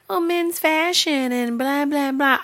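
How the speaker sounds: mock them in a high, whiny blah-blah; dismissive high singsong imitation; mocking excuse. Mocks excuse about men's fashion.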